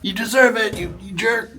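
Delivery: high, loud put-on scolding voice; mock jailer scolding. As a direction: scold the little prisoner in a silly voice. Voicing prisoner's captor, mock scold.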